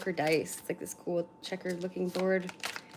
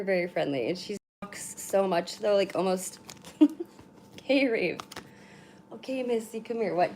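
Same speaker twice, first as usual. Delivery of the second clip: ordinary talk, nothing marked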